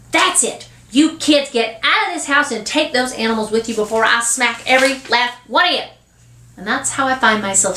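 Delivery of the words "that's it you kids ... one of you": higher-pitched fed-up outburst; snapping, hollering. Acted. Mother throwing kids out of the house, snapping. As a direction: lay down the law, at the end of your rope. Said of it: "finally snaps and hollers".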